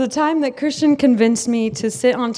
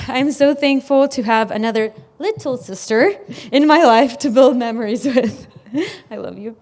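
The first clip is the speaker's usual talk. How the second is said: ordinary talk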